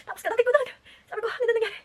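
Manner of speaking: high, loud panicked question; high-pitched frantic shout on arrival; frantic high cry. Retold or imitated. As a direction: burst in out of breath and alarmed.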